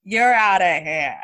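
loud, drawn-out mock dismissal; mock firing. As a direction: throw them out with hammed-up relish. Mimicking a ruthless firing.

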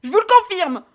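phrase hurlée haut et fort, pliée en deux; crie-le sous la contraction; hurlé. Acted. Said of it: "hurla la jeune femme". (Stacy hurle sa confirmation en se pliant de douleur)

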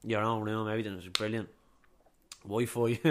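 ordinary talk, nothing marked

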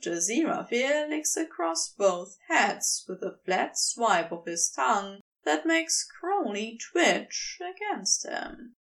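usual speaking voice

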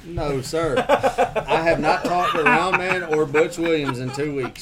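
slow, laughing denial. Real, not acted. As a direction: laugh through the words, slowly. Laughing while denying.

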